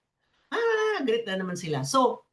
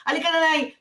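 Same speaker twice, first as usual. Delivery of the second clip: a higher, breezy "come on, Nay"; lightly voiced impatient caregiver coaxing mother; hurried caregiver; 8 semitones higher, faster than usual. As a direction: brush her off lightly and move on. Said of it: "sasabihin mo na lang".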